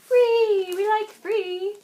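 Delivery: high, delighted cheer; excited. Real, not acted. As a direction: squeal it with glee. Excited about free pattern.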